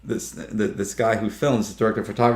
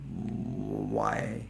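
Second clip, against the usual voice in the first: low, hushed, drawn-out why; mock-bewildered murmur; baffled disbelief; 4 semitones lower, 13 dB quieter than usual. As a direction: ask it quietly, sinking in pitch. Acts baffled question to coworkers.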